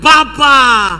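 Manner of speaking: loud, high call to father; a child's full-voiced shout; shouted cry. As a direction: cry out, drawn long. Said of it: "dia berteriak".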